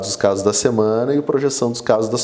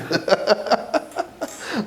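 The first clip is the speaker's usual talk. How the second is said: breathy, high burst of laughter; real amused laugh; 10 semitones higher, 5 dB quieter than usual. Real, not acted. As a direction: laugh heartily at your own story. Laughs after telling of arguing.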